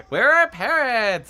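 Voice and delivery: high, loud, slow question; high, worried cry; anxious. A narrator's reading. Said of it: "I asked anxiously".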